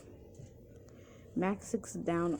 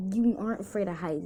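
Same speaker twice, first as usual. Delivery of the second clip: ordinary delivery, nothing marked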